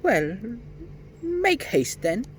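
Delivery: high, odd little gnome voice; sly gnome. A narrator's reading. Gnome tempting the children toward the magic well, sly and high. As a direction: play a sly gnome leading them on.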